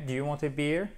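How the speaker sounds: ordinary delivery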